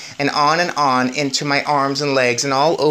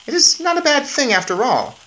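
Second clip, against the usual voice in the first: lightly voiced boy's thought; 12 semitones higher than usual. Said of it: "I thought to myself".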